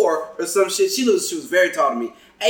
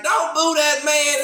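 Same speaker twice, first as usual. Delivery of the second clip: higher, drawn-out scolding of a crowd; imitated woman heckler; 8 semitones higher, slower than usual. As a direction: imitate a woman slowly telling off the audience. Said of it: "one chick that was like".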